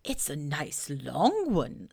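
slow, awed bear; impressed. Acted. Pooh admiring Owl's writing, slow and awed. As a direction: marvel at it slowly. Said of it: "said Pooh, very much impressed".

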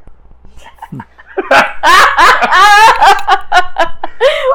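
shrieking burst of laughter; loud high laugh. Real, not acted. Big laugh at the name.

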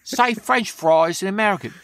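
high-pitched Essex English on FRENCH FRIES; Essex accent. Retold or imitated. Essex local requesting American words, high Essex accent. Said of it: "everybody talk like this".

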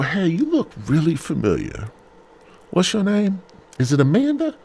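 retold greeting. Retells approaching woman at bar.

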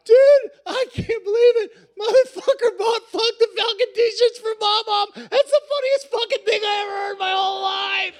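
can barely talk for laughing; laughing to tears. Retold or imitated. Stranger in the elevator howls about the Mom Mom shirts, laughing to tears. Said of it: "laughing till he's got tears".

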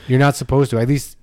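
usual speaking voice

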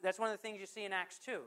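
ordinary talk

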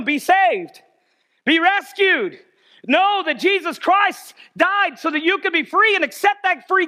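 high, pressing altar-call exhortation; urgent preaching. Real, not acted. Preacher urging church to be saved, urgent preaching. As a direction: plead with rising force, peaking on the love.

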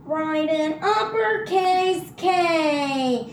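high, slow sing-song whine; mock a whining child; whiny kid voice. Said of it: "That's not just his voice".

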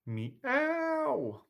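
high, drawn-out cat meow. Pretend. Reading a cat-themed title.

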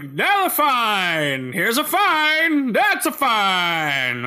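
drawled-out singsong repetition; slow mocking drone. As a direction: drag each word out, mocking. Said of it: "It's like".

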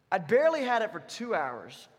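ordinary delivery

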